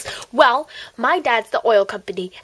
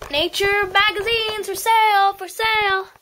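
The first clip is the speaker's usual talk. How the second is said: high sing-song seller's call; calling out wares; 8 semitones higher than usual. Acted. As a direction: hawk the magazines.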